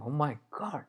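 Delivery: very soft, stunned exclamation; hushed realisation. Acted. Alexander dropping the water as truth flashes, hushed realisation.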